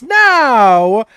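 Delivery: high, showy ringmaster voice; Mister Light asking Grevin to perform for the crowd, with flourish. Acted. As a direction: invite the star performer with grand courtesy. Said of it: "he says".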